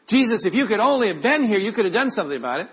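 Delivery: high, fast, loud complaint; loud reproach. Acted. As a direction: blurt an accusing lament at him. Mary's reproach acted as gossip starter.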